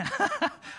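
sudden high laugh; high burst of laughter. Real, not acted. Laugh at old photo.

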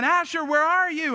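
put-on high-pitched call for a lost child; re-enacted searching cry, on the run. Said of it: "And I'm running, going".

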